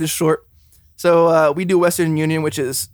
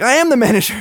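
higher-pitched, punchy retold comeback; emphatic retort; 7 semitones higher, 4 dB louder than usual. Store worker retells answering a customer's demand, emphatic retort. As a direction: land it like a triumphant punchline.

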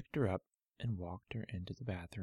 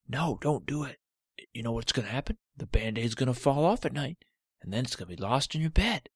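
louder, grumpy little toe voice; a cranky toe refusing the Band-Aid; stubborn talking toe; 3 semitones higher, 5 dB louder than usual. Acted. Cut toe protesting to Olivia, loud and grumpy.

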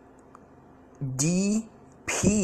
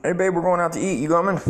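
higher, louder mock wife calling from the door; 7 semitones higher, 4 dB louder, faster than usual. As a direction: call him to dinner like a cheerful wife. Wife calling him to dinner.

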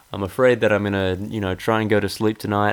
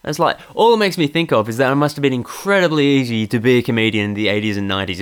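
ordinary talk

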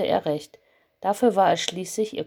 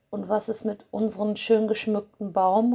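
ordinary delivery

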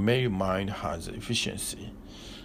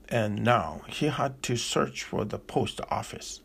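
usual speaking voice

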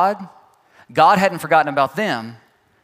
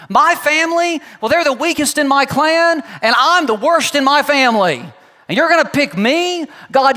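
higher, louder complaining, slowing down and fading at the end; whiny Gideon; 5 semitones higher, 3 dB louder than usual. Retold or imitated. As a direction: talk yourself out of being chosen. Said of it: "That's what Gideon says".

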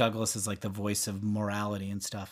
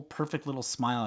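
ordinary delivery

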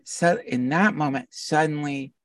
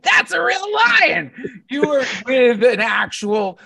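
much higher and louder, slow; emphatic shout; 11 semitones higher, 7 dB louder, slower than usual. Real, not acted. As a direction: shout it out as the big reveal. Punchline about the lion.

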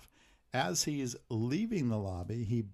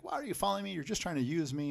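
high-pitched retold complaint; mock accusation; 7 semitones higher, faster than usual. Kate confronting Luke who follows her, mock accusation.

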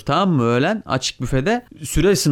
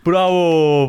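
a louder, higher cheer of bravo; retold shout; 5 semitones higher, 4 dB louder than usual. Friends cheering outside a hotel room, louder retold shout. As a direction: call out a cheer to the window. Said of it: "diye bağırıyoruz böyle".

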